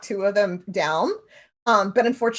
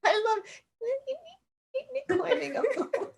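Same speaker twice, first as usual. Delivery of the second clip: laughing; 14 semitones higher, 7 dB quieter, much slower than usual. Pretend. Voices a character, laughing.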